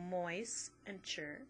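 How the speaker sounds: slow, split moist and chur apart; syllable split. Shown as a demonstration. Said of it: "separate it between".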